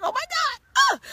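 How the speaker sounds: hyped about the move to Georgia; excited squeal. Retold or imitated. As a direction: burst out with giddy joy.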